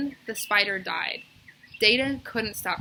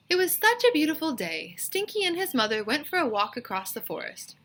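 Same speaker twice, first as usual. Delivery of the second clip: storybook reading; 8 semitones higher than usual. Acted.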